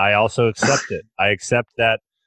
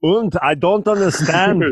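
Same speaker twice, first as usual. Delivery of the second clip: hammed-up German accent, higher and slower; lightly voiced German, a bit higher; mock German; 8 semitones higher than usual. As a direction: put on a jokey German voice. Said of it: "They're, they're like".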